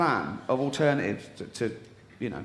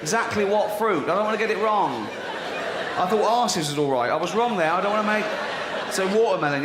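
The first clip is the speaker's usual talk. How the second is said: laughing incredulous rant; 6 semitones higher than usual. Real, not acted. Mocking the fruit detail.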